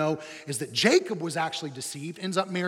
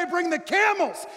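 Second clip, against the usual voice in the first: yelled order; 13 semitones higher, 5 dB louder than usual. Acted. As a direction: shout it much higher and louder to a helper. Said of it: "these guys say something like this".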